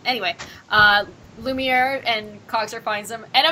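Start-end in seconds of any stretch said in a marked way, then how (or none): none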